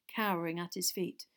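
ordinary delivery, nothing marked

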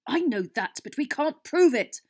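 loud, high, exasperated retort; bitter. A narrator's reading. Captain answering Harry about the excuse, bitter. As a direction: snap back bitterly. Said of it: "said Wood bitterly".

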